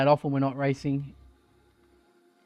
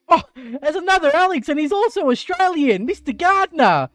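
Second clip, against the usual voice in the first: excited; 9 semitones higher, 3 dB louder than usual. Real, not acted. Spotting a fellow Australian.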